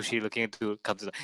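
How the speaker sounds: normal talking voice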